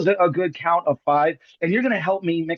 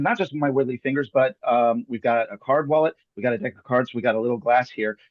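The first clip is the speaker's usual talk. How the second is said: usual speaking voice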